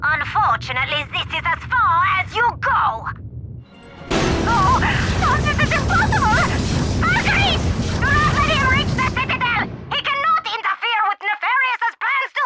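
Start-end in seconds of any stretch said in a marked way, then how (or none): 4.5-12.4 panicked shout. Acted. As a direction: panic and bark at the guards. Villain panics, orders guards.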